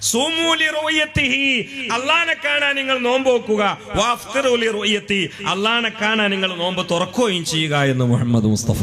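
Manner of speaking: high, ringing hadith recital easing down; preacher's proclamation. Acted. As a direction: proclaim it high, then settle gradually. (ഉച്ചസ്ഥായിയിൽ ഹദീസ് പ്രഖ്യാപനം)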